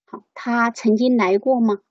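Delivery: usual speaking voice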